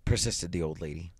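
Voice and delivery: ordinary delivery, nothing marked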